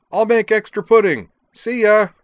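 high, loud parting holler; yell. A narrator's reading. Azalin signs off to Zoner from afar, yelling. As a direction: call out a loud farewell. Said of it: "yelled Azalin".

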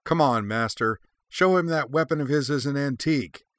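high, loud cheering yell; excited high-pitched shout; scream. A narrator's reading. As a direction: yell it out to egg on your master. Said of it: "Anakin Skywalker screamed".

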